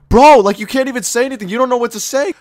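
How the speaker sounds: high, loud comic exasperation; exasperated outburst. Acted. Ranting at the student who can't speak, exasperated. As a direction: burst out high, starting with a loud "bro".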